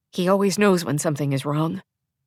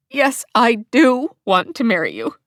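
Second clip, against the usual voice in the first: choked up; 4 semitones higher, 3 dB louder than usual. A narrator's reading. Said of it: "the words barely making it out of my throat".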